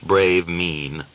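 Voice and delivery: ordinary delivery, nothing marked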